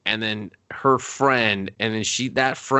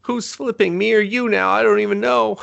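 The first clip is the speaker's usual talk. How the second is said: hammed-up bewildered despair, pitched way up; mock desperation; 10 semitones higher than usual. Onlooker lost in endless flips, mock desperate.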